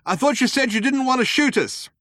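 high, loud indignant call; shouted protest. A narrator's reading. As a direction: shout your complaint across to the shooters.

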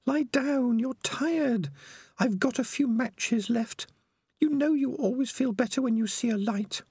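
put-on high, earnest young rat girl; Peaches, pleading and firm; young female rat voice. A narrator's reading. Peaches soothing.

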